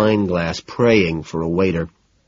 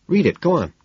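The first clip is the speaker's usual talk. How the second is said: high, coaxing encouragement; eager urging; 9 semitones higher than usual. Acted. Man urging his date to read aloud, eager. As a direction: prod them keenly to start. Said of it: "I urge enthusiastically".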